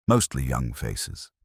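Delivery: ordinary delivery, nothing marked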